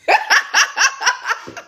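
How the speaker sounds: long, high string of giggles; real high-pitched laughter; giggling laugh. Real, not acted. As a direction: laugh hard at your own impression. Laughs at own impression.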